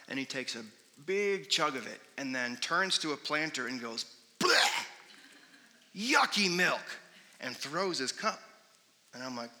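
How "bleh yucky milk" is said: put-on high, loud childish yuck; kid's disgusted yell. Little boy tasting spoiled milk, loud disgusted yuck. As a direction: spit it out like a grossed-out little boy.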